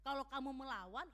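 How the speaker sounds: usual speaking voice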